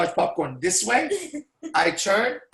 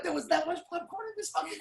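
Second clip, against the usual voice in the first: incredulous; 10 semitones higher, 8 dB quieter, faster than usual. Retold or imitated. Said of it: "I'm like".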